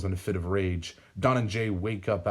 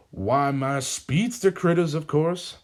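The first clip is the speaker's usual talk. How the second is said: slow, higher, louder gloating; villain showing off his speedster animals, gloating; proud villain; 8 semitones higher, 5 dB louder, slower than usual. A narrator's reading. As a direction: savour it like a proud showman. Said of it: "Sam explains".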